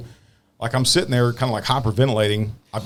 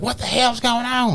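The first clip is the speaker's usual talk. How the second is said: mock puzzled woman; 12 semitones higher, slower than usual. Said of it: "She's like".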